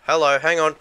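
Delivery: loud, high startled outburst. Real, not acted. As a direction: react suddenly to something popping up. Startled by creature appearing nearby.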